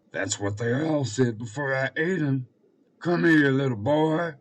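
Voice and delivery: high, loud menacing fat man; boastful fat man. Acted. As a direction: threaten like a hungry fat man.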